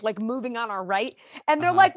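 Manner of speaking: ordinary delivery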